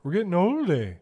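high, loud put-on Canadian voice; hammed-up Canadian with a big "eh"; Canadian accent. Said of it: "is that a very native Canadian".

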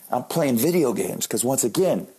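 ordinary talk

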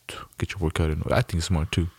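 ordinary talk, nothing marked

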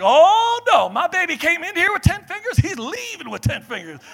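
high, loud indignant protest; mother refusing the doctors, loud and high; protective mom outrage. Retold or imitated. Mom loudly refusing to let doctors cut his finger. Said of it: "And she was like".

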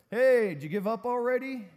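high, loud call across a distance; yelling over. Acted. Big guy yells over to the resting scrawny guy, loud taunting call. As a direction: holler it over to someone far off. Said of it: "So he yells over".

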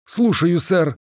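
high-pitched military acknowledgement; obedient adjutant. A narrator's reading. Adjutant told to send the visitor away, obedient. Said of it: "сказал адъютант".

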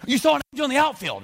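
very high, loud guessing voice; mock charades guess. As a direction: blurt out a wild charades guess. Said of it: "And they're like".

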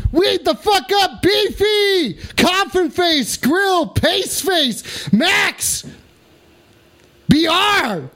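excited shout-outs. Real, not acted. Shouting greetings to friends.

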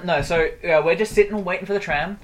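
ordinary talk, nothing marked

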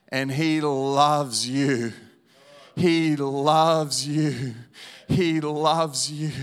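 booming, drawn-out declaration; loud slow preaching. Acted. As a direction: proclaim it slowly to the whole room.

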